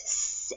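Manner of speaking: unvoiced snake-like hiss. Mother duck hissing at the fox, a sharp hiss. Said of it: "Mom hissed".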